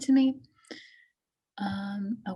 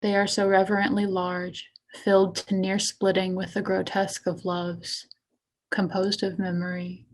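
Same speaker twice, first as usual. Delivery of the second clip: poetry reading. Acted. Poet reading self-mutilation piece to an audience, poetry reading. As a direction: give each image its own phrase. Reads own poem aloud.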